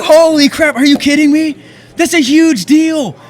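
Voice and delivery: very high and loud, stretched out with disbelief; thrilled shout. Retold or imitated. Telling family about the job, thrilled and loud. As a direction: shout the big news. Retold joy on phone.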